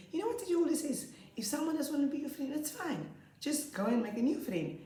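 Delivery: put-on high, earnest child voice, a bit fast; wise little boy. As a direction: lecture a friend like the teacher. Boy repeating teacher's advice.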